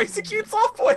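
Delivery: squeaky laughing high tease. Real, not acted. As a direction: laugh it out teasingly. Teasing, laughing.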